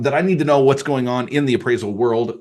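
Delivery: ordinary talk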